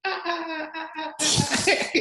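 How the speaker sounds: high, echoing fake laugh; high-pitched "ah-ah-ah" cackle, played up; mock loud laugh. Shown as a demonstration. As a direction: imitate a big laugh bouncing round an empty room. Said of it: "trying not to laugh too loud".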